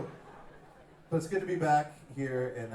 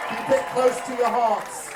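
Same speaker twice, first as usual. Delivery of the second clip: loud, high "Keep it close to your hearts" preached at the crowd; mock sermon; 11 semitones higher, 13 dB louder than usual. Singer urging the crowd to keep faith, loud preaching. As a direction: proclaim "close" with full preacher force. Rallying crowd, then repeats softer.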